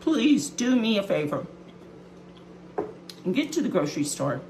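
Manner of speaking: hurried and muffled with mouth full. Real, not acted. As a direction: talk around a bite of cheese. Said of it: "I know I'm talking with my mouth full".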